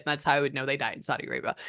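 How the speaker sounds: normal talking voice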